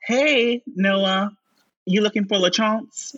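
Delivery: tossing the question across the dressing room; Romeo's line. Retold or imitated. Romeo calling to Noah across the dressing room, lightly voiced. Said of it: "Romeo, uh, says".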